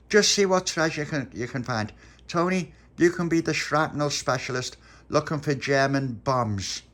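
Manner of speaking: put-on high, loud little boy giving orders; bossy boy leader. Pop assigning treasure jobs to his friends, high bossy voice. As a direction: play the kid in charge.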